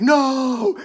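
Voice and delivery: high, drawn-out imitated "no"; mock desperate cry. Acting out Mother Anastasia's cry.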